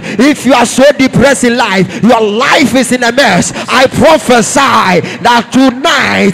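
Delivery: impassioned, loud sermon delivery; full-force preaching. Real, not acted. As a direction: preach it out to the congregation.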